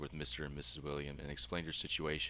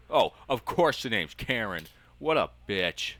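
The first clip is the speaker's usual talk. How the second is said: loud, higher and slow, openly indignant; outraged loud scoff; 7 semitones higher, 13 dB louder, slower than usual. Real, not acted. Player mocking the mother's letter, loud indignant scoff. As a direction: scoff hard, like you can't believe it.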